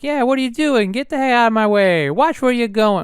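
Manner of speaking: pushy, badly faked New York accent; hammed-up New York accent, telling someone off; horrible New York accent. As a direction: put on a bad impatient New Yorker accent. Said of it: "That's a horrible New York accent".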